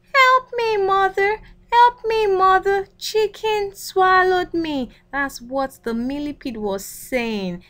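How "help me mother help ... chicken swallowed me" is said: high, loud pleading cry to mother; frightened cry for help. Acted. Swallowed millipede crying to his mother, high and loud. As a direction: shriek for mother as if swallowed whole. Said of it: "Millipede then cried".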